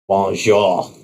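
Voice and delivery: put-on low-key, flat French accent. Bishop, introduced as French-born producer, flat French accent. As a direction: greet in an unenthusiastic French accent. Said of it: "says in a flat French accent".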